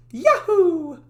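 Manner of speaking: high, loud Mario-style yahoo; cheery Yahoo. Acted. Dev testing a character voice, loud Mario-like whoop. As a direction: shout it like a jumping plumber.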